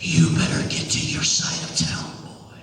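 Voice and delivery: low, hushed warning voice; menacing mother. Acted. Mother warns boy to go home, low hushed threat. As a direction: drop it way down into a near-whispered threat. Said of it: "she looked at me and she says".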